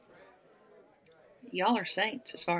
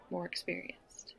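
suggestive murmur; 10 dB quieter than usual. Acted. Flirting with a younger man.

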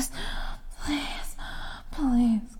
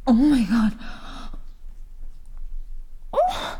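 climax cries; 2 semitones higher than usual. Acted.

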